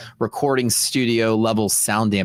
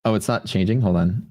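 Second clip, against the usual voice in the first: normal talking voice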